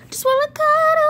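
high, loud cuddly squeal. Real, not acted.

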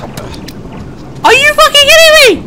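very high, loud shriek of disbelief; real gamer rage, high and loud; outraged scream. Real, not acted. Outraged at dying in game.

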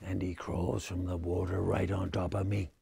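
usual speaking voice

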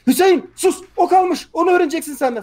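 loud, high-pitched commander's reprimand; bağıran komutan taklidi. Retold or imitated. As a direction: cut the recruit off with an imitated yell. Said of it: "komutan bağırıyor". (askeri azarlayan komutan bağırışı)

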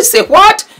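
high, loud disbelieving cry; shocked. Retold or imitated. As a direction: squeal in disbelief. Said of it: "I said".

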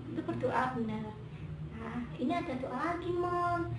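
usual speaking voice

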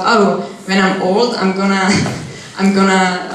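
ordinary talk

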